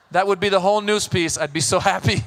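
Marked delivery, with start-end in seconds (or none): none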